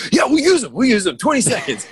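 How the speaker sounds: mock eager producer. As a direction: grab the idea like a desperate producer. Imitating eager producer accepting design.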